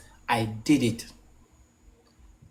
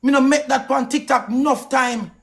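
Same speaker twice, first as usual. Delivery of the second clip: ordinary talk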